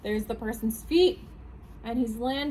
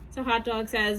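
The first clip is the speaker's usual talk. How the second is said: usual speaking voice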